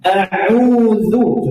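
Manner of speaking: A'udzu with a thin dal; A'udzu sung clearly; recitation demo. Shown as a demonstration. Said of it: "Coba kita perhatikan".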